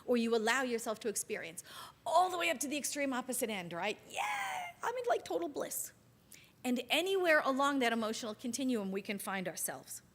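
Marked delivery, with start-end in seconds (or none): 4.8-5.9 a sudden high, giddy cry of bliss; total bliss. Pretend. Describing the top of the emotional scale, giddy and blissful. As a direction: burst out as if overjoyed.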